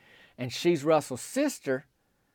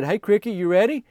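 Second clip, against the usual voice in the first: higher, louder friendly call; upbeat greeting; 6 semitones higher, 6 dB louder than usual. Retold or imitated. Retold cheery greeting at concession stand.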